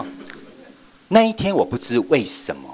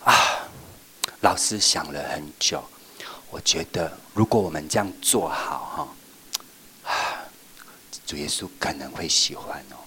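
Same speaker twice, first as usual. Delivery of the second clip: soft, lower, secretive whisper; whispered suggestion; 6 semitones lower, 3 dB quieter than usual. Shown as a demonstration. Teacher whispering an idea to the children, soft. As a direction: lean in and share the idea like a secret. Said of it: "你要演出".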